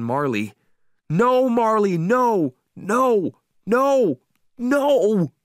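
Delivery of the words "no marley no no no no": high, loud repeated cries; frantic shouting. A narrator's reading. Owner horrified by dog's mess in water, frantic shouting. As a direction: cry out in horror, each one a full yell.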